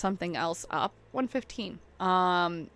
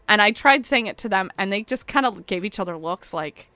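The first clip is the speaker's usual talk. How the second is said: normal talking voice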